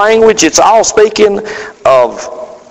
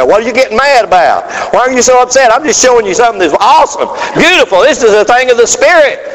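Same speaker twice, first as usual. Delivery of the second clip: puzzled brother; 6 semitones higher than usual. Retold or imitated. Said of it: "I don't know why they get mad".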